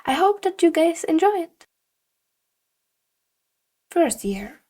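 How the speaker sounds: ordinary delivery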